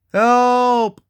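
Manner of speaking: high, drawn-out call for help; cry for help. Acted. Lured explorer calling to be saved, cry for help.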